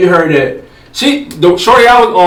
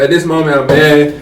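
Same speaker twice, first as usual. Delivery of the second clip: normal talking voice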